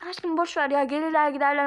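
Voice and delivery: usual speaking voice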